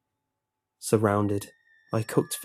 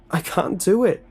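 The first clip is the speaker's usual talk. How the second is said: much higher and louder, pleading in fear; 9 semitones higher, 5 dB louder than usual. A narrator's reading. Tied-up boy pleading with the lunch lady, frightened. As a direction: beg her to stop.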